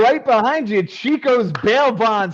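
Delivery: high, loud announcer hype; hyped draft announcer. Acted. Host announcing Jason's draft slot, excited announcer. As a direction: build it up like a sports introduction.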